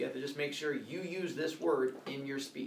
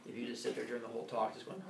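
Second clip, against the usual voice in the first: ordinary talk, nothing marked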